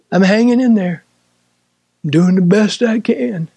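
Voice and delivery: lightly put-on struggling reply; a touch of mock just-getting-by; acting faint. Preacher mimics a believer who is only coping, mildly faint. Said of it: "I will act faint".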